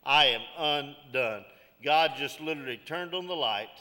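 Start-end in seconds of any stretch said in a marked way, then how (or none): 0.0-1.5 very slow, solemn confession. Retold or imitated. Isaiah confessing to the Lord, slow and solemn. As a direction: stretch each word in contrition. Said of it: "and he says".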